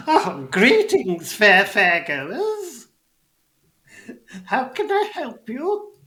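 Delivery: high, drawn-out goblin greeting; loud, high old goblin, stretching out the words; elderly goblin. Acted. Elderly goblin hails fairgoers from the ticket booth, high elderly goblin voice. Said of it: "there is an elderly goblin".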